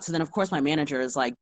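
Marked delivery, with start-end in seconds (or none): none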